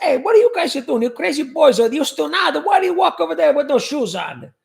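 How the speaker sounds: high, loud scolding nonna voice; mock nonna yelling at barefoot kids; yelling Italian grandmother. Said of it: "when she used to yell at us".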